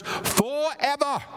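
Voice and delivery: hammed-up English accent, pitched high; non-rhotic English accent. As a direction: give it a playful English lilt. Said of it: "say that with an English accent".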